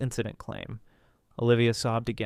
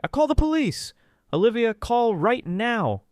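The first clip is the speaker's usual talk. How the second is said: high, loud, urgent shout; 12 semitones higher, 5 dB louder than usual. A narrator's reading. Brother shouts for the police, high and loud. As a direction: yell it as you run to help. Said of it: "Ray ran to him shouting".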